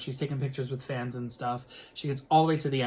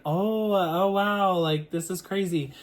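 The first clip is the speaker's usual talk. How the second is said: flattered surprise; 6 semitones higher, 3 dB louder, slower than usual. Retold or imitated. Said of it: "And I'm like".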